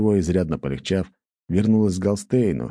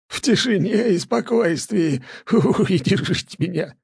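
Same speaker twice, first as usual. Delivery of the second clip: тишине mocked high, slow, wheezing with laughter; laughing mockery; 14 semitones higher than usual. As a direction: echo his words, choking with laughter. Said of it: "всхлипнул он". («в тишине» передразнивая, захлёбываясь смехом)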